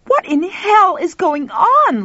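high, loud, slow outraged demand; drawn-out furious cry from screech to roar. A narrator's reading. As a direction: start in a screech and end roaring. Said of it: "starting as a screech and ending as a roar".

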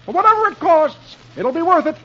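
high, cheerful exclamation; Dagwood. A narrator's reading. Dagwood pleased with the cleaners, high cheerful voice. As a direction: sing out happily, pitch way up. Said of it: "Dagwood says cheerfully".

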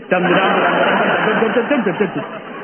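hummed melodramatic music cue, sung; mock dramatic sting. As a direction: sing a spoof film sting. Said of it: "mock dramatic music".

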